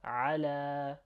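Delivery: "على" with its final a held long; lengthened "la" closing "على"; stretched A. Shown as a demonstration. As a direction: draw out the end of "على". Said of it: "we're stretching the A sound".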